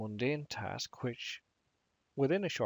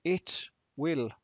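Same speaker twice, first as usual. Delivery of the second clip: usual speaking voice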